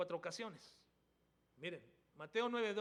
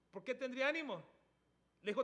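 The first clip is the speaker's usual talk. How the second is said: ordinary talk, nothing marked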